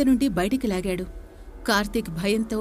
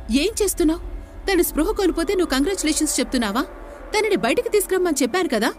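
higher, rushed scolding of a careless worker; serious scolding; 7 semitones higher, faster than usual. A narrator's reading. Boss scolds his assistant after the girl faints, serious scolding. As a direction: tell her off for getting it wrong. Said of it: "సీరియస్గా తిడుతూ".

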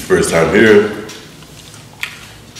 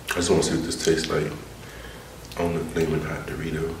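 soft whisper to herself; breathy whispered aside; 3 semitones lower, 15 dB quieter than usual. Real, not acted. Whispering while making chip.

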